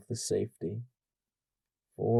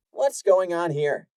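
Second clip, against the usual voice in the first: commanding, louder question; authoritative; 9 semitones higher, 7 dB louder than usual. Acted. Senior mare stepping in, authoritative. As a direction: take charge of the scene. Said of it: "in a more authoritative tone".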